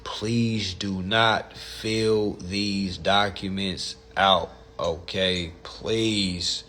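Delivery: slow, stress on not; earnest slow plea; pleading. Real, not acted. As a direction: drag it out, begging. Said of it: "pleading to viewers".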